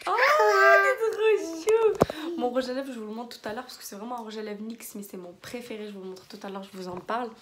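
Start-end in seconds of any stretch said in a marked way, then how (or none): 0.1-2.4 high, slow, cooing; tender. Real, not acted. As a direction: coo it fondly. Tender high exclamation to child.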